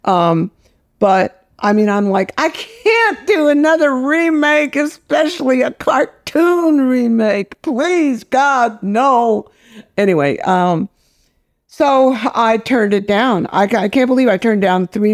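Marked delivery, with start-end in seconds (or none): 2.4-9.5 high-pitched, drawn-out exasperated plea; mock-desperate, stretched-out refusal; pleading refusal. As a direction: beg off it like a dramatic protest. Retold plea against remake.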